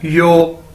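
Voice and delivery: यो like the "yo" in "yo man"; pronunciation demo. Shown as a demonstration. Teacher answers how YOU is said, pronunciation demo. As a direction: model यो as one held syllable. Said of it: "कैसे बोलते हैं".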